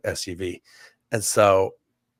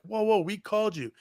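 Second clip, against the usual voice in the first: alarmed protest; 11 semitones higher, 3 dB quieter than usual. Retold or imitated. Narrator pleading with armed cop.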